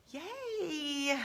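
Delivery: soft, high, half-hearted yay; mock cheer that doesn't really care; quietly drawn-out, noncommittal yay; imitating husband's indifference. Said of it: "I don't really care".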